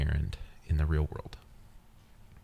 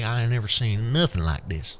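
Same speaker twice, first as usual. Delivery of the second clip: put-on Southern accent; 5 semitones higher than usual. Imagined onlooker insists on amazement at the juggler, Southern accent. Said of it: "you have a Southern accent".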